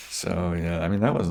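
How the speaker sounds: usual speaking voice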